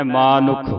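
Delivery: ਮਾਨੁਖ as maa-nukh; long first vowel. Shown as a demonstration. Teacher corrects the misreading, ਮਾਨੁਖ with long aa. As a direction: hold the aa after m in ਮਾਨੁਖ. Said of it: "ਮਾਨੁਖ ਬੋਲਣਾ ਹੈ".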